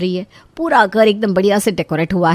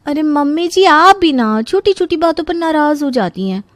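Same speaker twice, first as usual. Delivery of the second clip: high, coaxing singsong; light, cajoling protest; placating daughter-in-law; 7 semitones higher than usual. Acted. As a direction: brush off the scolding sweetly in a raised pitch.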